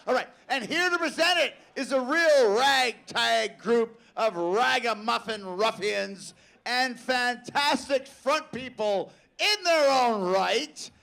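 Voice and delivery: slow, building hype announcement to a shout; award announcer. Acted. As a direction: build the intro up to the big name. Announces final presenters.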